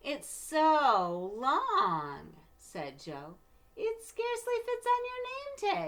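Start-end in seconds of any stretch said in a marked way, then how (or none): 0.0-2.4 very slow, stretched teasing; mocking drawl. Mocking classmate voice. 3.8-5.9 put-on high, loud, bratty kid voice; teasing little girl. Classmate Rita teasing.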